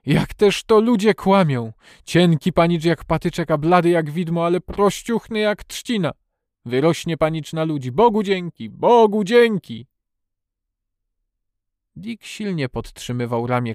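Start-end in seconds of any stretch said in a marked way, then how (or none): 0.1-9.9 put-on high, loud, overjoyed old man; exclaiming with moved relief; emotional outburst. A narrator's reading. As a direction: burst out in wonder, building to fervent thanks. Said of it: "wybuchnął".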